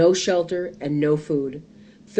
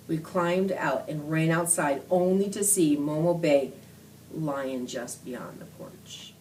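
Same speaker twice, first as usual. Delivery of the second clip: usual speaking voice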